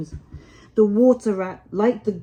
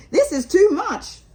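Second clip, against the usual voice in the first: much higher, louder exclamation; high excited Mole; 10 semitones higher, 6 dB louder than usual. Acted. Mole exclaims to Rat on the river, high and excited. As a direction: burst out in high delight. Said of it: "inquired the rat seriously".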